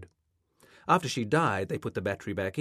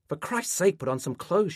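higher, louder start, easing off; exasperated scolding; 8 semitones higher, 4 dB louder than usual. A narrator's reading. Mother telling her son to dress, exasperated scolding.

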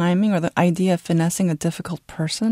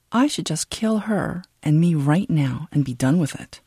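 usual speaking voice

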